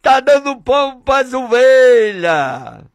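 high, drawn-out preaching cry; impassioned pulpit delivery; fervent preaching. Real, not acted. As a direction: proclaim it with mounting fervour, stretching the endings. Preaching at full force.

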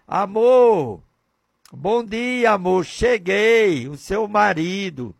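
high, loving greeting; sweet words. Acted. Husband greets his wife with beautiful words, high and loving. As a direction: sweeten every word for the wife. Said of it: "outras palavras diferentes, bonitas".

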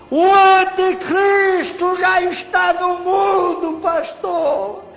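high, drawn-out wailing cry; imitated tearful plea. Crying man begging the preacher to preach, imitated anguished wail. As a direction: implore loudly, as if crying out. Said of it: "E chorando".

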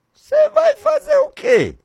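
high voice of God asking Samuel. Acted. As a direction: ask it as God from above. God questioning Samuel, acted dialogue.

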